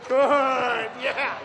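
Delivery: loud, high cheering shout; excited cheer. Real, not acted. Coach praising after a drill.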